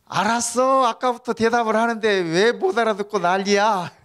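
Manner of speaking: high, loud, fed-up retort; exasperated yell. Retold or imitated. Wife yells back at her hard-of-hearing husband, exasperated. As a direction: scold back at the top of the voice.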